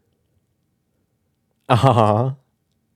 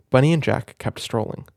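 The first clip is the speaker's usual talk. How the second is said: ordinary delivery, nothing marked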